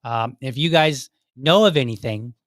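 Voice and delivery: ordinary talk, nothing marked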